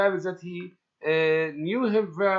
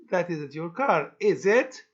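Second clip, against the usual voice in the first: ordinary talk, nothing marked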